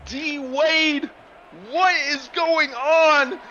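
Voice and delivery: high, loud, screaming play-by-play; hyped commentary shout. Real, not acted. Commentator going wild at the tied game, shouting. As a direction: lose it completely over the big play, yelling. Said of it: "I'll try to keep the voice down".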